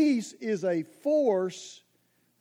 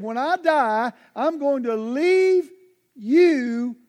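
louder, slowing down hard on "you my peace"; a loud, drawn-out "you my peace"; solemn bequest; 2 semitones higher, 4 dB louder, much slower than usual. Acted. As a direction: hand it over like a last will. Quoting Jesus' bequest.

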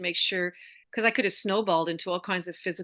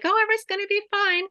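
high, fast, sing-song fake cheer; squeaky, rushed mock optimism; mock breezy denial; 13 semitones higher, 5 dB louder, much faster than usual. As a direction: toss it off like a carefree airhead. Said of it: "That denial would not serve me".

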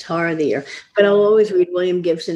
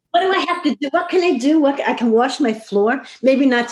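much higher, bubbling over with amused glee; giddy excitement; 8 semitones higher than usual. Real, not acted. Guest laughs at finally having time to vacuum, giddy and high. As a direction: squeal it like chores are a treat. Joking about chores after deadlines.

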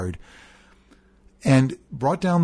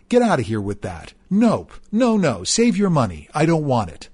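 dismissive mean tone; 3 semitones higher than usual. Retold or imitated. Mother scoffing at father's anniversary dinner invitation, dismissive and mean. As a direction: sneer every refusal down at him. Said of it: "addresses him with the most dismissive, meanest tone".

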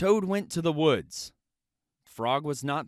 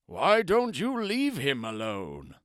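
turtle voice. Acted.

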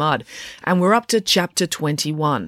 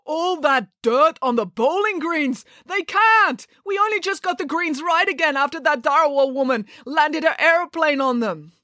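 put-on high, appalled Russian-accented wail; horrified; 12 semitones higher than usual. A narrator's reading. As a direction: cry out in horror with a Russian accent. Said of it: "Mister Popov was horrified".

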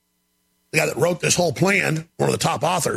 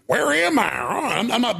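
mock confused Biden; 6 semitones higher than usual. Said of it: "It's like".

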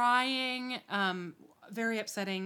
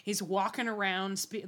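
normal talking voice

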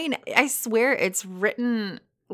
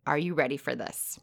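ordinary delivery

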